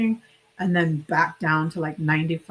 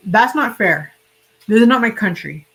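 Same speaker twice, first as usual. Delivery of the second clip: retold loud protest; 3 semitones higher, 7 dB louder than usual. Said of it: "I said".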